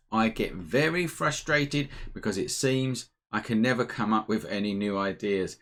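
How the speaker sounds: ordinary delivery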